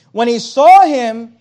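"saw" slow, loud and leaned on; emphatic reading. Shown as a demonstration. Preacher reading scripture aloud to the church, emphatic. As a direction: drag out "When he saw him" loud. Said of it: "I want you to notice the emphasis".

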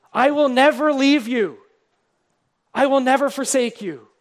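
loud, ringing declaration of the promise; proclaimed promise. Acted. Preacher to his church, booming the promise. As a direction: proclaim it over the congregation.

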